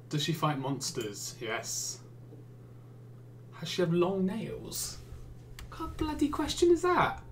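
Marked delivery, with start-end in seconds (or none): 5.7-7.2 high, incredulous outrage; how dare you ask that; baffled, exasperated shriek of disbelief; indignant outburst. Real, not acted. Indignant at a silly question.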